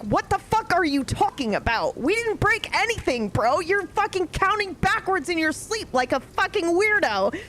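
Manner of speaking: high, heated disbelief; incredulous. Retold or imitated. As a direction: push back in disbelief. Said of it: "I'm like".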